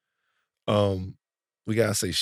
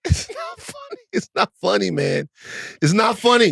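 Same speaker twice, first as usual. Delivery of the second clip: laughing protest; 8 semitones higher than usual. Real, not acted.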